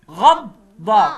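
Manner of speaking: slow, loud غض then ضا; syllable split. Shown as a demonstration. Teacher splitting the doubled ض for kids. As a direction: sound out غض and ضا apart. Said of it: "غض، وبعد كده ضا".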